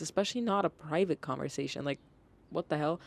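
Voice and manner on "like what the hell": ordinary delivery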